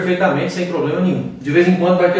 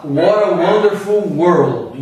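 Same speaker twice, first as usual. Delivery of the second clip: slow American accent, "What a" run together with a flap; 2 semitones lower, much slower than usual. Shown as a demonstration. Teacher quoting a classic song title, American accent. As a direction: say it American, T melting into the vowel. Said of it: "O americano fala".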